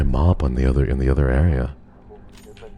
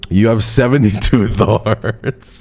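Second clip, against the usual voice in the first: laughing tease; 7 semitones higher, slower than usual. Real, not acted. Teasing a chatter, laughing.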